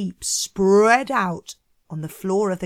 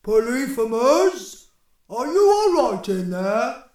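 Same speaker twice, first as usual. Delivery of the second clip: louder, put-on giant neighbour voice; Cyclops calling in; 3 semitones higher, 5 dB louder than usual. Second Cyclops checking on his neighbour from outside, calling in. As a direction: call through to someone behind a boulder. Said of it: "he called in to Polyphemus from outside".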